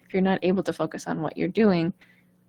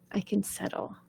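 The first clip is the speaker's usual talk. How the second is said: soft settling whisper; 9 dB quieter than usual. Retold or imitated. Body voice calms itself.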